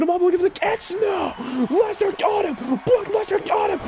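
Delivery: high, urgent play-by-play yell; excited shout. Real, not acted. Commentator as Lesnar catches Ace, excited shout. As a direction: sell the reversal like it shocked you. Calling a caught move live.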